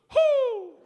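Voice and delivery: high loud owl hoot; dad's owl hoot. Retold or imitated. Dad on the dock hooting to call his owl, high hoot. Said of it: "gets on the edge of the dock and goes".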